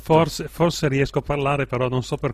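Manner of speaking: talking through hiccups. Real, not acted. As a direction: speak while hiccuping. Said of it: "Col, col singhiozzo".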